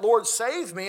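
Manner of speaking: a full-voiced plea of "Lord, save me"; crying out. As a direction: cry out to be saved from sinking. He cries out.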